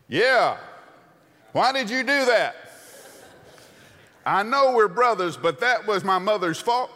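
high, loud mock piling-on; sniping brother. Aaron siding with the angry crowd against Moses, loud and high. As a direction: join the crowd and blame your brother. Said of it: "Aaron didn't kind of step over going".

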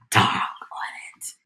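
slow disappointed whispered curse. Retold or imitated. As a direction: mutter it under your breath, slowly. Disappointed the wedding goes ahead.

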